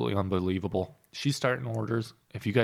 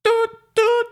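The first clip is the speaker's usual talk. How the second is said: high, louder toot, toot on request; 9 dB louder than usual. Shown as a demonstration. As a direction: blast the toot like a party horn. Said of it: "A little louder".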